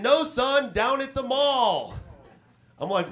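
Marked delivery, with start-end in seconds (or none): 0.0-1.9 higher, slow matter-of-fact dad; imitating dad. Dad setting his son straight, slow mock dad voice. Dad's reply voiced in story.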